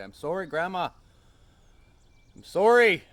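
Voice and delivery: anguished apology. Retold or imitated. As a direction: call out an apology to the sky. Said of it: "hold my hands up and say".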